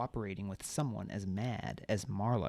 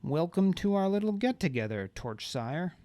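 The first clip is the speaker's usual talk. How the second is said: menacing welcome; 6 semitones higher, 5 dB louder than usual. A narrator's reading. Marla's menacing greeting.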